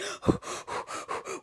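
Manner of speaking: quick puffing breaths, acted out; imitated panicked gasps. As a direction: gasp as if overwhelmed.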